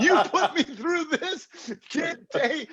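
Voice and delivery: high and laughing, mock indignant; retold exasperation, laughed out high; laughing mock outrage. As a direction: squeal it through laughter at the friend. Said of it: "She said".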